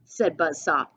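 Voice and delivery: put-on storyteller reading a picture book to kids; read-aloud storybook voice; story narration. A narrator's reading. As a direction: paint the western showdown for the class.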